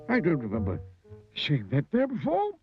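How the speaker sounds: high puzzled character voice, rising; Mister Greedy. A narrator's reading. Greedy walker eyes a new cave, puzzled high voice.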